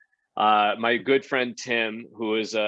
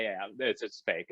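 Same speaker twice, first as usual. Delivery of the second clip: sheepish admission; 5 semitones lower, 8 dB quieter than usual. Retold or imitated. Crew admitting the prank.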